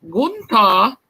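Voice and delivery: mock German accent. Shown as a demonstration. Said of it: "and German is".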